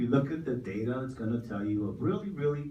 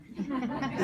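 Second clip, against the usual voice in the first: brief amused laugh, higher; light laugh; 11 semitones higher than usual. Real, not acted. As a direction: let a short laugh slip out. Speaker laughs at own line.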